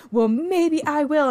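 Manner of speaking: hammed-up, high, loud and slow; overdone. Shown as a demonstration. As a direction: overact it with a big, drawn-out high swoop. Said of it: "it's overdone".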